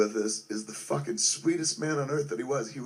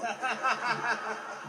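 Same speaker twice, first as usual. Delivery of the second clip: burst of laughter; 11 semitones higher than usual. Real, not acted. Laughing mid-story.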